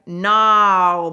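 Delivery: loud, drawn-out "no"; retold amazed exclamation. Saleswoman hearing customer got a better deal, amazed. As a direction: belt it out in happy disbelief. Reacting to customer's good news.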